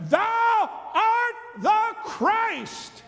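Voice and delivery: slow, high, ringing proclamation; proclaiming shout. Acted. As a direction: cry it out slowly, full of awe. Peter proclaims Jesus Messiah.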